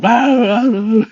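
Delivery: loud, high mock dog yapping; imitated frantic barking. Storyteller imitates his friend's frantic beagle, mock barking. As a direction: yap like a dog going nuts.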